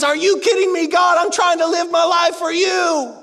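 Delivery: high, loud mock outrage at God; offended outburst. David offended, yelling up at God. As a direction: shout it like a hurt, offended man. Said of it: "He gets real offended at God and says".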